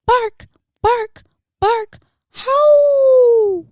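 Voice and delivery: high, loud dog bark and howl; howling alarm bark from a hilltop. A narrator's reading. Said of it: "Pongo sent the alarm".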